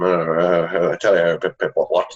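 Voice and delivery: put-on light caricature of a British accent; hammed-up, mumbly stock-Englishman British accent; mock British accent. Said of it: "an English accent or a British accent".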